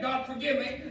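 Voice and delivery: ordinary delivery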